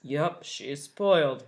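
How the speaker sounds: low, slow grumpy mutter; Waffle's voice. Acted. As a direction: grumble it sourly. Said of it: "Waffle said to himself".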